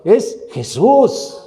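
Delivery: "es Jesús" said slowly, with every syllable of "Jesús" drawn out and weighted; stress on Jesús. Acted. Preacher correcting his congregation on the name, slow emphatic "Jesús". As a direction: plant the word "Jesús" slowly and firmly. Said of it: "Así que en México no es Yeshua".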